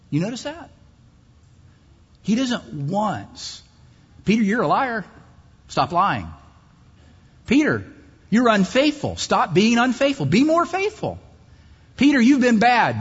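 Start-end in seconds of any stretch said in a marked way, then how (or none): 4.2-13.0 higher, louder pretend rebuke of Peter; imagined Jesus lecturing Peter, mock scolding. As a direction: scold him like a stern teacher, hammed up. Imagined scolding Jesus never gave.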